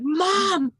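put-on high, panicked German-free kid voice on the verge of tears. A panicked sixth grader calls mom about the beer. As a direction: squeal it like a terrified twelve-year-old.

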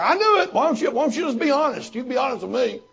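put-on old pastor friend catching him hiding something, triumphant. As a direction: catch him out and crow about it. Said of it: "And then he would be like".

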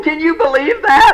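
high-pitched incredulous appeal; mock disbelief. Speaker asks crowd to share his shock, mock disbelief. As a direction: play it scandalized, pitched well up. Mock outrage at being quit on.

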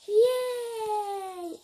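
high loud cheer; happy gamer cheer. Real, not acted. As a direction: squeal with delight. Cheering in game.

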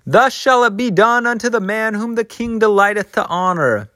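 high, ringing mock proclamation; high-pitched town crier's proclamation; proclaiming.

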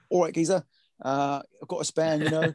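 a hint of a cheeky London geezer; Mark Watts London geezer voice. Producer voicing Mark's London geezer accent on a call. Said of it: "here I'm putting my worst Mike Watts accent".

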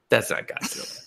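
hushed, breathy repeat of That's; whispering That's not Godzilla to herself; whispered aside. Retold or imitated. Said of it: "I was just like".

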